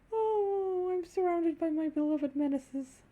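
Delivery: sing-song slow sigh; slow, high, fond scold; fond exasperation. Real, not acted. Sighing fondly at the friends.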